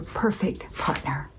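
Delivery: slow, soft whisper; hushed reveal. Retold or imitated. Doctor telling her what the list is about, hushed. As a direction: whisper it slowly like the big reveal.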